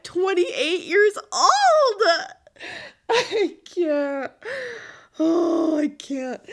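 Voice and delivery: loud, slow, high laughing cry; laugh-crying at her own situation; laughing despair. Real, not acted. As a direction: let it burst out laughing. Laughing despair about parents.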